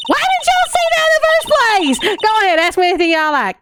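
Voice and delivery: lightly voiced Southern lady, offended; Southern accent. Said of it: "give her the southern accent".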